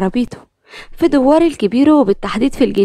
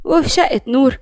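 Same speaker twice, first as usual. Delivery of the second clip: plain reading voice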